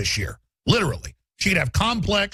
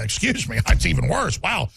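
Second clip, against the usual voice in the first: ordinary talk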